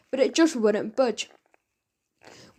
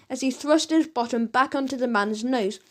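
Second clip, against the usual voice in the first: plain reading voice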